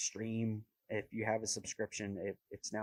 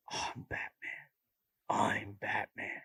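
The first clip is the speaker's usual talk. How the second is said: slow gravelly-free raspy whisper as Batman; hoarse, slow Batman growl-whisper; imitating the Batman voice; slower than usual. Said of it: "the voice was made so popular".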